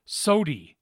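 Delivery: sody, said with a long o and ee ending like soda-ee; regional sody. Retold or imitated. Saint Louis vendor's word, sody. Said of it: "they called it sody, not soda".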